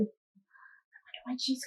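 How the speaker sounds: hushed whisper of suspense; soft curious whisper. Acted. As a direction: whisper it like a secret.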